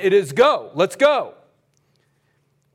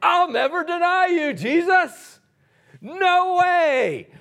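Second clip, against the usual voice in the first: much higher, eager protest; Peter's bold vow; 12 semitones higher, 3 dB louder, slower than usual. Retold or imitated. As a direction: play Peter swearing loyalty. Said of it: "as the one saying".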